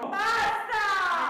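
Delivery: loud exasperated cry of Basta; frustrated shout. Acted. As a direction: yell it out, fed up. Final outburst.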